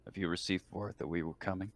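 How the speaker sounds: ordinary delivery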